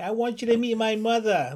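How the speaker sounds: high-pitched put-on New York accent; mock New Yorker accent. Shown as a demonstration. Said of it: "that New York accent".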